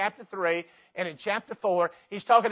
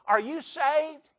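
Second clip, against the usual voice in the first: questioner; 8 semitones higher, slower than usual. Acted. Acted exchange about salvation.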